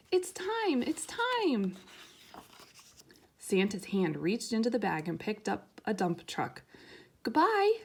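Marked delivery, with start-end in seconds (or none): 0.1-1.8 high excited call from a crowd of toys; toys shouting. Acted. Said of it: "a shout went up". 7.2-7.8 very high, loud shouted goodbye. Acted. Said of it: "shouted the dump truck".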